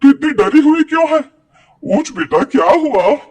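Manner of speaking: alarmed motherly urgency; worried mother. Acted. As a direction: rouse your child from a nightmare with mounting concern. Mother waking frightened daughter from nightmare.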